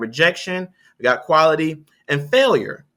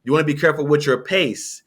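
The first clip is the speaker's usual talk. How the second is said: ordinary talk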